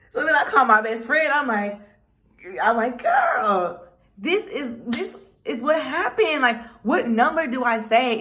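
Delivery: breathless with news, hands thrown up; excited retelling. Retold or imitated. Excitedly telling best friend what happened.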